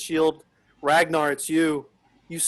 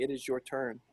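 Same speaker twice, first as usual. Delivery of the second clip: usual speaking voice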